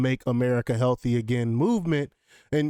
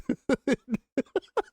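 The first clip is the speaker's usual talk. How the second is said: high burst of laughter; 11 semitones higher than usual. Real, not acted. Laugh ending the segment.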